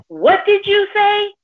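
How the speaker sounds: high, drawn-out indignant mock yell; retold yelling. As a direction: mimic an outraged man confronting someone. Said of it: "He's yelling at me".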